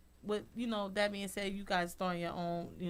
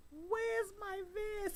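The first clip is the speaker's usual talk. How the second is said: distressed; 14 semitones higher, much slower than usual. Retold or imitated. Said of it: "It was just like".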